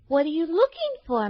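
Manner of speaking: put-on high, sweet little girl asking kindly. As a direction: play a gentle young girl.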